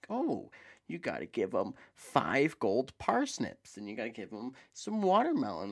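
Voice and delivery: swooping, exaggerated pitch on each demand; hammed-up sing-song fetch-quest voice; mock quest-giver. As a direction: play up a silly list of chores. Mocks the game's tedious fetch tasks.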